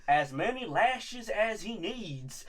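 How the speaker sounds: very slow put-on Southern drawl; drawn-out Southern slave-owner impression; mock Southern accent. Friend imitates actor's slave owner, slow Southern drawl. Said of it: "that is how he sounds".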